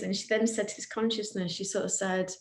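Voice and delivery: normal talking voice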